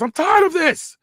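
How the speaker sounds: high, loud, slow angry bellow; the boss losing his temper in a high shout; furious shout. Acted. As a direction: yell at them both to end it now. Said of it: "Paul yells".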